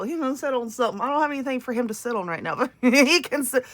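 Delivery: ordinary delivery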